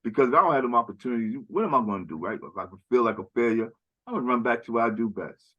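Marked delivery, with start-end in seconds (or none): none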